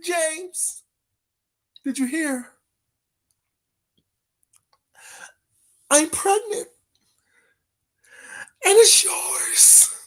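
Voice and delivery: high, hushed melodrama; movie-scene impression. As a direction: play the tearful confession, high and drawn out. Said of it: "What movie is that from".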